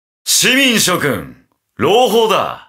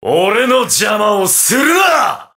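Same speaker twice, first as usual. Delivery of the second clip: high shout, slightly held back; angry shout; 7 semitones higher, slower than usual. Acted. Fighter warning off whoever blocks him, high angry shout. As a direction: shout it angrily without a full scream. Battle line, second take.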